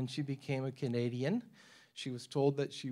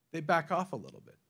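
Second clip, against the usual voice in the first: normal talking voice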